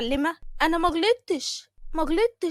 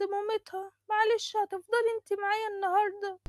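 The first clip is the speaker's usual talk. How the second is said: put-on higher, gentle old aunt voice; 8 semitones higher than usual. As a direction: voice the pleading aunt, pitched up. (صوت الطنط العجوز، أعلى وبرجاء)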